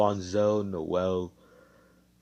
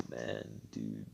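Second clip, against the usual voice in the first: low, soft, fed-up mutter; exasperated groan; 11 semitones lower, 12 dB quieter than usual. Acted. Asta to Mars after stopping his suicide, weary exasperation. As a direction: deflate with disappointment.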